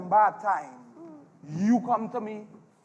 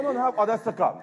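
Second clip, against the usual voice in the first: ordinary talk, nothing marked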